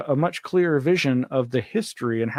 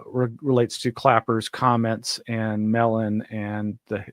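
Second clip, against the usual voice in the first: ordinary delivery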